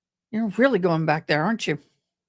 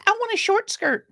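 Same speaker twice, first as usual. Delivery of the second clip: high mock girly demand; imitating her whine; 11 semitones higher than usual. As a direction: mimic her asking, pitched way up. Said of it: "She goes".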